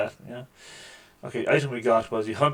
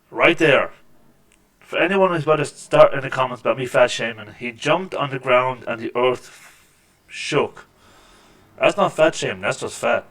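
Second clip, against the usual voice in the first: much louder, worked up over the slam; excited loud commentary; 8 dB louder than usual. Real, not acted. Gamer defending a fat joke to viewers, loud and excited. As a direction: call it out big and loud.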